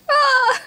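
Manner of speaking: short high wail of anguish; high-pitched wail. Real, not acted. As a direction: let out a high, overwhelmed cry. Overwhelmed by the sad scene.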